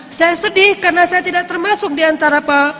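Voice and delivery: high-pitched retold outpouring of regret; impassioned lament. Puncak pengakuan sedih orang saleh. (ungkapan sedih bernada tinggi)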